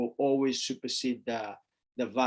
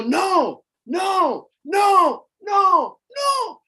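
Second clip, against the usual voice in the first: much higher and louder, rising with each no; parent shouting no; 7 dB louder, much slower than usual. Retold or imitated. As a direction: yell no at the toddler pulling the curtains. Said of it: "you go".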